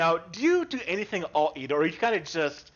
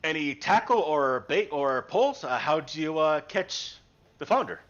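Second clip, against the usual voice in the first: lower, unhurried humble fisherman; gruff-free friendly old fisherman, lower voice; fisherman Dale; 8 semitones lower than usual. Acted. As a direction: speak as a polite, simple fisherman.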